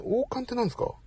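a soft, half-to-himself "王冠ってなんですか？" trailing off; quiet puzzled question. Real, not acted. Streamer quietly wondering aloud at a chat comment, soft puzzled question. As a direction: mutter "王冠ってなんですか？" quietly, falling at the end.

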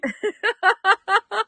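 bursting out laughing; big happy laugh; loud laugh. Real, not acted. Happy after live show.

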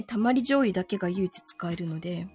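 ordinary talk, nothing marked

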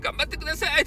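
high-pitched mock cheer; imitated squealing cheer. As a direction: mimic an excited fan in falsetto. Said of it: "とか黄色い声援が来たらね".

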